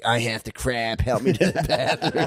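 imitating a needy bedridden whine; mock helpless adult. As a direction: plead like a helpless adult needing the toilet. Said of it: "you in bed being like".

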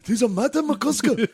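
high-pitched mock Japanese exclamation; mock Japanese accent. Joking about a player under attack, mock Japanese accent.

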